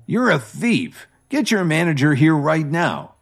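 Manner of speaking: angry accusation. Acted. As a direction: call him a thief and demand the manager. Drunk guest accuses the waiter.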